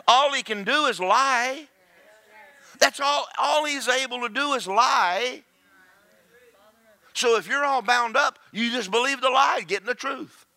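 full-force preaching. Real, not acted. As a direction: drive the point home from the pulpit.

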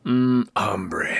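a hint of a grizzled cowboy. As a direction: lightly growl it like an old gunslinger. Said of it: "Does everybody wanna try their grizzled voice?".

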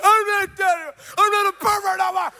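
high, loud retold scream; mock screaming. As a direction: yell it out in hammed-up protest. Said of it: "I'm, like, screaming".

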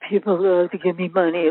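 low, glum mock-depressed voice; Eeyore. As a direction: drone it dejectedly, hammed up. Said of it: "like Eeyore".

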